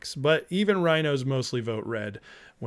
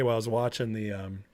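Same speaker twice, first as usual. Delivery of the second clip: usual speaking voice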